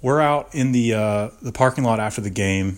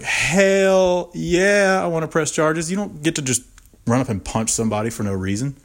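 emphatic indignation; 7 semitones higher than usual. Retold or imitated. Victim telling officer he'll press charges, emphatically indignant.

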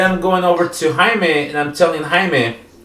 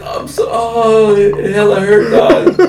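drunk mock wail; 4 semitones higher, slower than usual. Drunk friend in the back seat complaining, mock wailing. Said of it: "he's like".

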